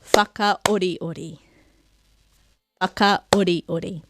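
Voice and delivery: Whakaoriori, slow, leading with a stressed Wha; stress on first syllable. Shown as a demonstration. Teacher saying place names aloud, first-syllable stress on Whakaoriori. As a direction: stress the Wha of Whakaoriori, then drop away softly in an even rhythm. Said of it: "so you can hear with a clap where that".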